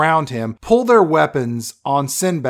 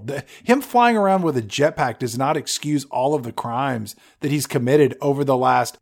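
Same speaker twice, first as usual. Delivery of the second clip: ordinary talk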